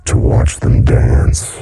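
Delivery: slow, rasped, gurgling monster voice; lightly voiced diseased creature gurgle; diseased raspy gurgle. A narrator's reading. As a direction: gurgle it like a rotting throat. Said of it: "gurgled in a diseased, raspy voice".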